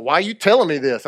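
sassy, confrontational challenge. Shown as a demonstration. Preacher showing how not to confront a gossip, sassy. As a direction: get all sassy with the question. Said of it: "get all sassy and be like".

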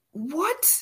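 high, baffled what; disbelieving what. Retold or imitated. Disbelieving exclamation.

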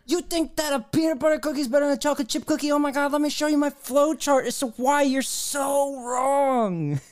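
hammed-up scandalised debater; mocking a cookie argument, high-pitched and scandalised; mock outrage.

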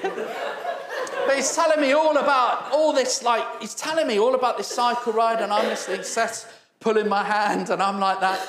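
laughing through the words. Real, not acted. Preacher laughing while retelling the cyclist's long story. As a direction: chuckle while telling it.

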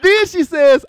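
laughing through the words, high and loud. Real, not acted. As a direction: retell it while cracking up. Cracks up while retelling the scene.

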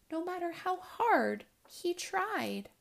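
ordinary delivery

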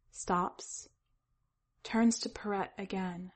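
ordinary delivery, nothing marked

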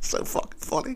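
high breathless laugh through the words; cracking up at his own bit. Real, not acted. Host cracking up at his own joke, laughing.

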